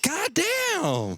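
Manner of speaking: high-pitched exasperated yell. Real, not acted. Fan worked up over the snake argument, yelling high. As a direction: cry it out, pitch way up. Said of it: "Don't mean to yell like this".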